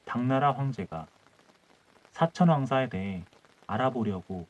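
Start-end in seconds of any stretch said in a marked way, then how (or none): none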